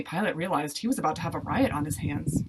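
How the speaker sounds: plain reading voice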